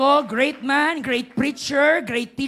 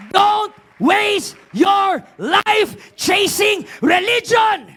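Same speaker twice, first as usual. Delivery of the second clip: loud, high, driving sermon shout; full-force preaching; 7 semitones higher, 7 dB louder than usual. Real, not acted. Preacher urging the congregation beyond religion, full-force shout. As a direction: preach it at the top of your voice, punching each phrase.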